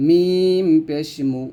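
drawn-out মিম পেশ মু with lips rounded; teacher's slow Quran letter drill; slow demo syllable. Shown as a demonstration. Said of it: "যেমন".

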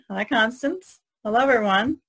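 ordinary delivery

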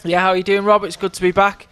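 lively live play-by-play; race commentary. Acted. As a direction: call the race as it happens.

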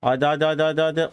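rapid-fire repeated urging. Real, not acted. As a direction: rattle it off to spur it on. Urging the car on.

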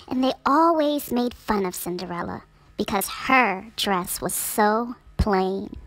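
ordinary delivery, nothing marked

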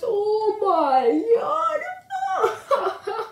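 high, hammed-up horrified reaction; mocking impression of shock. Prankster imitates Paul's shocked face, mockingly. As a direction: mimic a terrified victim, drawn out. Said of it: "You were like this".

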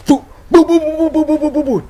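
loud, high booming noises; rapid-fire mock cannon "boo"s; imitated cannon fire. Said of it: "the deep voice cannon".

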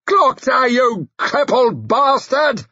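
high, loud furious snarl. A narrator's reading. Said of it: "he snarled".

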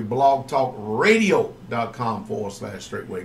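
slow, clear repeat. Shown as a demonstration. Radio host repeats the web address for listeners, slow and deliberate. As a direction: spell it out slowly so listeners can write it down. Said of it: "host repeats web address".

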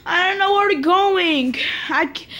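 high, wailing lost voice; high-pitched play-acted distress; pretend panic. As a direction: whine as a frightened, lost figure. Lost figure panics over road.

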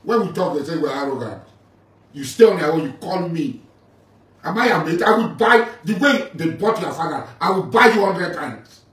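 furious shouting rant; outraged shouting down a rival. Real, not acted. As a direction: yell it at him, furious and loud.

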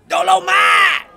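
big, high, drawn-out shout of the name; loud, stretched-out yell; character shout. Acted. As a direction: holler it like a showman's catchphrase. Announces the character's name with gusto.

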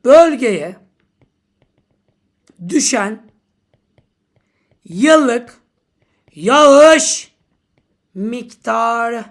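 each word shouted out separately with pauses; dramatic slow read. Shown as a demonstration. Teacher reading the exam question aloud, declaiming. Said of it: "Şöyleydi".

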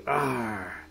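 ordinary delivery

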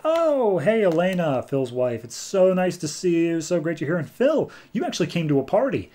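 mock gushing, high-pitched party hello; gushing greeting. Party guests welcome Elena and Phil, imitated gushing. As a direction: greet them with exaggerated, swooping delight.

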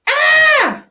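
high loud yelp of shock; sharp high scream of surprise; startled shout. Acted. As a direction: shriek at the sudden surprise. Child startled by dog mess.

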